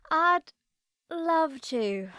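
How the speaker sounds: drawn-out, sarcastic acceptance. A narrator's reading. Narrator answering her friend's note to herself, sarcastic. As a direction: break it up with mock eagerness. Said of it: "I say to myself with sarcasm".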